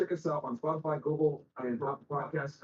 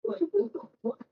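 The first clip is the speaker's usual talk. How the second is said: high, incredulous what the fuck; mock disbelief; 13 semitones higher than usual. Podcast host retelling the crew's reaction to Fresh, shocked squeal. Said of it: "So we're like".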